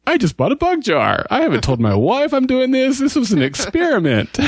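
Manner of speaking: high, panicky self-quote; panicked disbelief. Retold or imitated. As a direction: replay the moment of panic. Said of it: "And I'm like".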